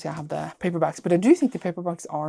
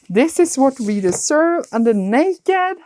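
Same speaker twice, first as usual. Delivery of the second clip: excited; 6 semitones higher, 4 dB louder, slower than usual. Real, not acted. Repeats the line louder.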